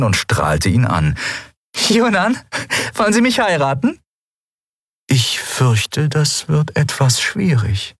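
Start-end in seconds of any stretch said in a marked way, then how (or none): 1.9-4.0 higher, bright playful woman's voice; a cheeky captain teasing the eidolon; young woman Endriel. Acted. Endriel proposes teasingly to Yunnan, playful high voice. 5.1-7.9 lightly voiced otherworldly being, slow; gently declining; calm eidolon. Yunnan dryly declines Endriel's proposal, lightly voiced and slow.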